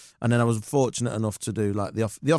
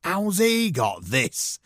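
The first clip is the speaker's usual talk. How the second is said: mocking heckler; 7 semitones higher, much slower than usual. Extra heckling on set.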